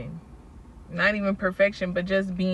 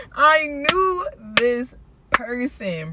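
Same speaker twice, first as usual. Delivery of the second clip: near tears; 5 semitones higher, 5 dB louder, slower than usual. Real, not acted. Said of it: "I'm about to cry".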